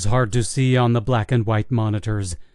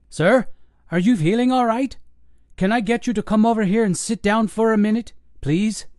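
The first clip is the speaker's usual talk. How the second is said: higher, gentle coaxing voice; soothing paramedic; 10 semitones higher than usual. Acted. Paramedic calming the agitated old man, coaxing. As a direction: coax him to sit, patient.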